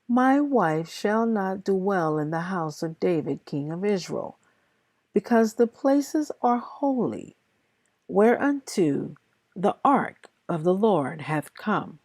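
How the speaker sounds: ordinary talk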